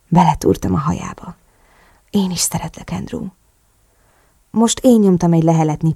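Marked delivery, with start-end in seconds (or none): 2.1-3.3 soft, hushed reply; tender murmur. A narrator's reading. Narrator returning partner's love, tender murmur. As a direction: answer gently, fading on the name.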